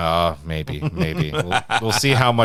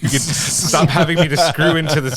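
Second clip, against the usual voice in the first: exasperated complaint; 5 semitones higher, 4 dB louder than usual. Real, not acted. Complaining about mic stands.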